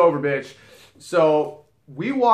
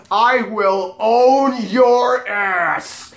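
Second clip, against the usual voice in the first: slow, drawn-out yell, higher and louder; eager shout; 6 semitones higher, 5 dB louder, much slower than usual. Retold or imitated. Bouncer hyping himself up to pounce, slow shout. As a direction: bellow it like a predator licking its chops.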